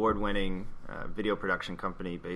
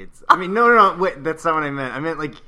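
flustered; 7 semitones higher, 11 dB louder than usual. Real, not acted. Flustered backpedal after a gaffe.

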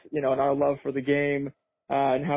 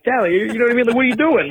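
exasperated at dad; 10 semitones higher, 7 dB louder, faster than usual. Retold or imitated. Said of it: "And I'm like".

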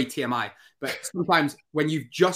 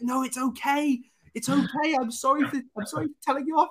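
reassuring the dog; 12 semitones higher than usual. Retold or imitated. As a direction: coo it to a guilty puppy. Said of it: "And I was like".

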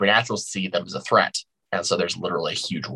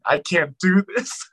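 mock whine; 10 semitones higher, slower than usual. Mocking her own cringe reaction.

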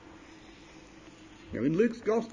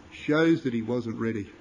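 usual speaking voice

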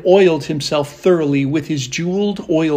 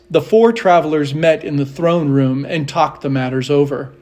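usual speaking voice

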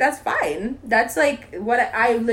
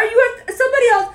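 ordinary talk

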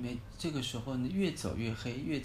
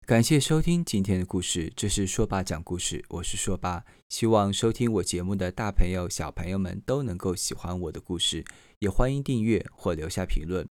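louder, announcer-style outro read; podcast sign-off; 3 semitones lower, 8 dB louder than usual. Acted. Storyteller dad thanks his listeners, announcer-style sign-off.

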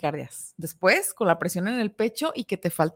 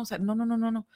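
usual speaking voice

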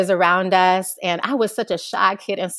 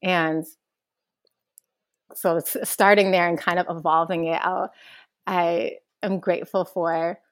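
usual speaking voice

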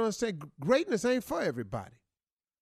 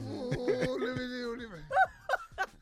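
crazy man voice; 9 semitones higher than usual. Pretend. Crazy man answering questions.

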